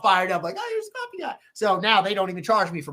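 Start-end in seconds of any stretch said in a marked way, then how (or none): 0.6-1.4 much higher mocking voice. Imitating the fired-up customers, high mock voice. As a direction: squeak it like the cheering customers.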